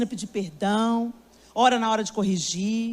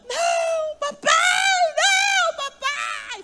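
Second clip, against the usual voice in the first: put-on much higher, wailing little girl crying out; child's dramatic wailing; much slower than usual. Granddaughter wailing to be rescued from punishment, dramatic child cry. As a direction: ham up a toddler's tantrum. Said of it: "Fazendo um drama no quarto".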